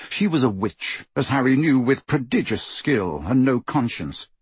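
plain reading voice

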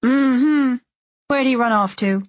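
high, loud knowing hum, then a prying question; nosy woman, unconvinced; sceptical busybody. A narrator's reading. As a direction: hum doubtfully, then pry.

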